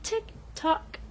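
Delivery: high, sing-song mocking tick tock, trailing into a whisper; snotty tick tock. [name] taunting Lizzie for stalling, high mocking sing-song. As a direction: taunt her with the clock, high and airy. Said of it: "[name] delivers some major attitude".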